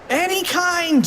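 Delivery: high-pitched exasperated shriek; frantic high cry; mock scream. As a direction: squeal it like a panicking cartoon kid. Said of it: "screamed Fluffy".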